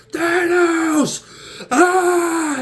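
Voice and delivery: high, loud yelling cry; mock battle cry. Retelling the fight, yelling like the hero. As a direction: charge in screaming.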